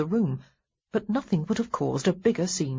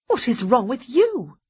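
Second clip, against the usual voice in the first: higher and louder, stress on you; indignant retort; 6 semitones higher, 5 dB louder than usual. Acted. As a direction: hurl it back at him.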